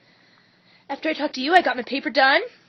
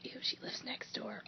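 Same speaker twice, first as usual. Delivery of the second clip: hushed gossip whisper; 16 dB quieter than usual. Real, not acted. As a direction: whisper it so nobody hears. Gossip about neighbor.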